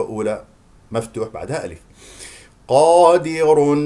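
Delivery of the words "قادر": loud, slow recited قادر with a dark long qa; heavy Q. Shown as a demonstration. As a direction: stretch a full heavy قا in قادر. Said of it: "التفخيم لحروف الاستعلاء". (قادر بقاف مفخمة)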